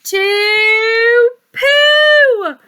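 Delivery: slow, high, loud sing-song; drawn-out punchline. A narrator's reading. Reader building the big joke for the child, slow and sing-song. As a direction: stretch it out for laughs.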